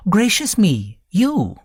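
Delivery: high-pitched exclamation of surprise; startled. A narrator's reading. Wife finds an unexpected caller in the room, startled. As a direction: jump up in pitch, taken aback.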